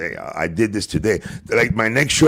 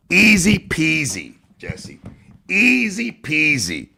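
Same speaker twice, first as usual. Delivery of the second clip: taunting singsong; 9 semitones higher, slower than usual. Real, not acted. Taunting a rival.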